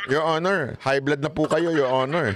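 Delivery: low, sweet teasing courtesy; mock soothing a hothead; mock politeness. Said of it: "Hindi ikaw pwede magsabi". (panunuyang magalang)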